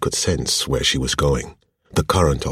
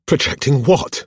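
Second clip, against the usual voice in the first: high, loud indignant question; sharp retort; 12 semitones higher, 7 dB louder than usual. A narrator's reading. Woman challenging her partner's accusation, indignant retort. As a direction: fire it back at him, pitch leaping up. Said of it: "she shot back".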